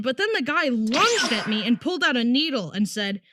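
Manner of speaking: ordinary talk, nothing marked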